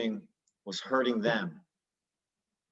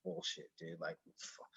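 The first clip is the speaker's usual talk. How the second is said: whispered, much softer angry grumble; pissed mutter; 7 semitones lower, 18 dB quieter than usual. Retold or imitated. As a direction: grumble under your breath, fed up. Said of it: "just pissed".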